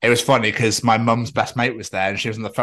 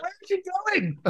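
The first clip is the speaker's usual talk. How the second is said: very high, softened mock shout; a small, high yell as if from far off; calling after walkers; 6 dB quieter than usual. Calling after people walking away, mock shouting. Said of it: "Like, people walking away".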